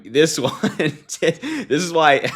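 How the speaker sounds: cracking up mid-phrase; real laughing. Real, not acted. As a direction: try to read it out but break into laughter. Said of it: "I'm laughing at some".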